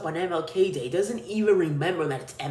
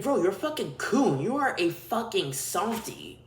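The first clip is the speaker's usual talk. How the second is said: usual speaking voice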